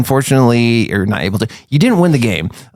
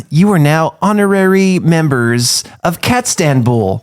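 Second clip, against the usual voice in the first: slow, higher, formal proclamation; ceremonial note reading; 6 semitones higher than usual. Acted. Reading the note from the envelope, slow ceremonial announcement. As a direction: announce it slowly and grandly.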